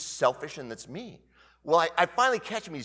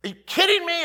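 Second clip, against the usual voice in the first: high, loud disbelief; incredulous outburst; 13 semitones higher, 9 dB louder than usual. Retold or imitated. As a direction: blurt it out like you can't believe it. Inner reaction, retold.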